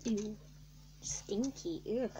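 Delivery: ordinary delivery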